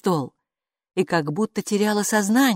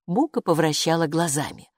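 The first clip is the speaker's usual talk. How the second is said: usual speaking voice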